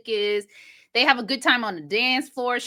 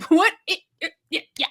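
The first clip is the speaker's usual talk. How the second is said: normal talking voice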